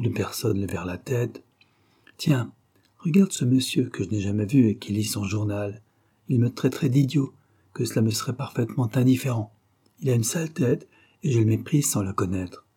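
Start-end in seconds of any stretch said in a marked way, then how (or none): none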